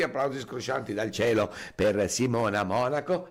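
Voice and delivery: ordinary delivery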